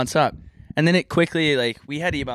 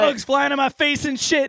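frantic yelling; 14 semitones higher than usual. Retold or imitated. Continuing the reporter's meltdown.

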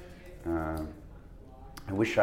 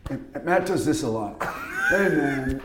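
half-hearted Matt Dillon impression; 4 semitones higher than usual. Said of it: "a half-hearted".

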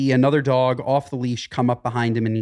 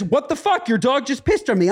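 high, indignant mock outrage; outraged woman; 9 semitones higher than usual. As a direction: play the furious owner, pitched way up. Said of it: "The woman looks at me and says".

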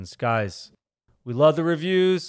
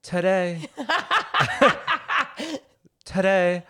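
put-on laughing English accent; 5 semitones higher, slower than usual. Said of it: "You go".

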